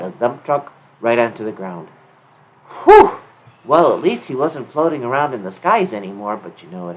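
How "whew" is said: relieved high whew. A narrator's reading. Sliding out of truck.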